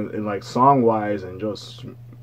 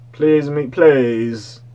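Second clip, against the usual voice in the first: pleading call; 4 semitones higher, 7 dB louder, much slower than usual. Real, not acted. Begging [name] not to flop.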